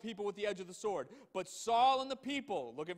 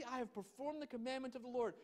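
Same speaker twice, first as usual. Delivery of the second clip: softer, higher skeptical repeat; mocking echo; 5 semitones higher, 5 dB quieter than usual. Shown as a demonstration. Preacher repeating Saul's claim, skeptically quieter. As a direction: echo his claim in doubt. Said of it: "Saul, really?".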